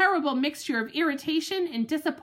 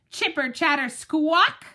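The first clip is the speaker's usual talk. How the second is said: squirrel chatter; 3 semitones higher, 3 dB louder than usual. Acted. Squirrel noise imitated.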